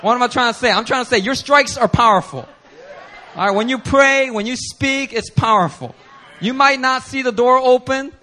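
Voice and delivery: loud, high preaching; rally the church. Real, not acted. Pastor urging the congregation about their prayers, loud preaching.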